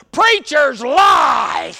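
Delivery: slow, loud flat-out declaration; old man's blunt shout. Retold or imitated. Old man telling the preacher preachers lie, slow loud shout. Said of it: "He goes".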